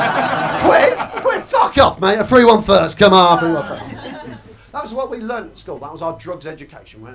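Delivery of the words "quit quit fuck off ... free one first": shouting drug dealer, louder and high on Quit; mock angry dealer. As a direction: bark it like a dealer chasing off a freeloader. Imitated dealer yelling at him.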